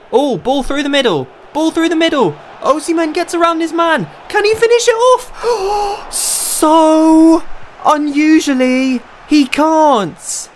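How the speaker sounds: high commentator call that breaks on the miss; excited play-by-play. Acted. Live play-by-play of a chance.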